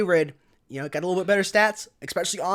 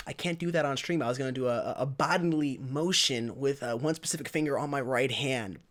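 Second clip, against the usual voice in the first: normal talking voice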